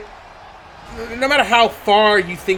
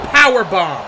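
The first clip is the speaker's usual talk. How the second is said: normal talking voice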